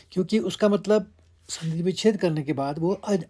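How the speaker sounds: ordinary talk, nothing marked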